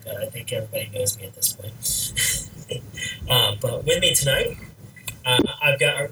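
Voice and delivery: ordinary talk, nothing marked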